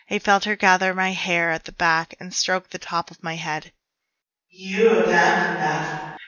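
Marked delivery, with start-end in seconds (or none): 4.5-6.2 slow, breathy hissing witch; serpent witch voice. Acted. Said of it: "her serpent voice breathed".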